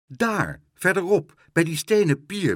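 high, loud pointing-out; urgent directions. A narrator's reading. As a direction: call out where it is. (dringend de weg wijzen)

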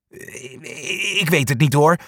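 hesitant stammer, first word breathless. A narrator's reading. Sja is asked to climb out, nervous stammer. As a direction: stumble over the words, unsure. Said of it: "stamelt Sja".

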